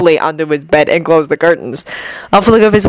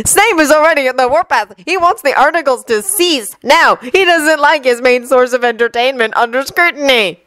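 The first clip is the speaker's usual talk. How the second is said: put-on high-pitched boyish character voice; lively, raised-pitch schoolboy; mischievous twin; 6 semitones higher than usual. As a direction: voice a joking twin passing on gossip, pitched up.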